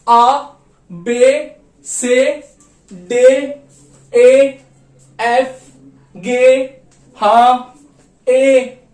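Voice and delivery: letters called out loud one by one; German alphabet recital. Shown as a demonstration. Teacher reciting German alphabet letter by letter. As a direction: read each letter aloud clearly. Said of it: "दोबारा से देख लेते हैं हम".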